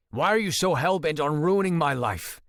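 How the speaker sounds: loud, high furious demand; angry shout. Acted. Ford yells at his brother after punching him. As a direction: yell it in his face.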